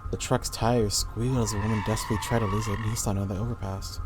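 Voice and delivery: usual speaking voice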